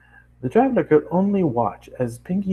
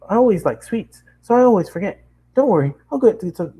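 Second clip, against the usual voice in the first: high, excited nonstop chatter; bubbly pony girl; 9 semitones higher than usual. Acted. Bubbly girl chatters at the new traveler, high and excited. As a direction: gush at the newcomer in a bright rush.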